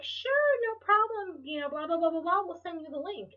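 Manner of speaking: high, singsong customer-service imitation; cheerfully obliging. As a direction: mimic an eager, helpful support team. Support team's obliging reply acted out.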